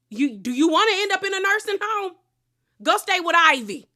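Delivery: high-pitched sassy warning to a parent; mock threat. Imagined comeback to a live-in mother, sassy threat.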